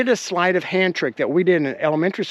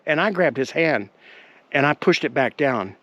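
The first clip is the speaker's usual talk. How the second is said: ordinary talk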